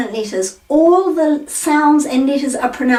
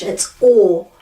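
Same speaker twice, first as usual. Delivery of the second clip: ordinary delivery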